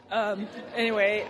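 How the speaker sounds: normal talking voice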